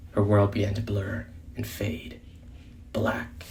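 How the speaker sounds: whispered, fading away. A narrator's reading. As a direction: let the voice die out. Narrating blackout.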